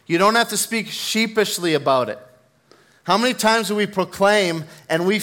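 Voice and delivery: loud, high preaching at full force. Acted. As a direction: preach it out with fire.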